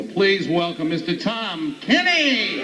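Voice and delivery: slow build to a high, stretched name; announcer introduction. Real, not acted. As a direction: bring the comic on with a big rise. Host introduces the next comic.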